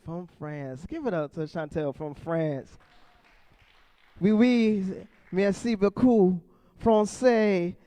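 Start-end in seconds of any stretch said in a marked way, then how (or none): 4.2-7.7 loud, high mock French flourish; hammed-up French. Comic to Chantel from France, loud mock-French flourish. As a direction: show off broken French to the crowd.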